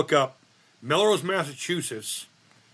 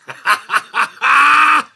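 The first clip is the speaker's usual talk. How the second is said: big laughing shout; 12 semitones higher, 13 dB louder than usual. Real, not acted. Cackling at his own insult.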